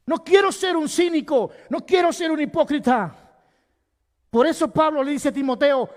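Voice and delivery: loud, high preaching voice; full-force sermon delivery; fiery preaching. Acted. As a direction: preach it out to the whole church.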